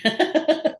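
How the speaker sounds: words breaking into high laughter; laughing. Real, not acted. Actor agreeing with host about Cheers, laughing high. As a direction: let the laugh take over the words.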